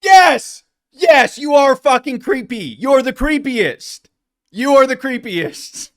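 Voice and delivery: loud, high, exasperated yell; shouting back. Real, not acted. Host shouting back at the podcaster, loud. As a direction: blast it back at her. Angry retort to the clip.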